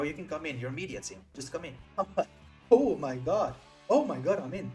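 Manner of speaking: ordinary delivery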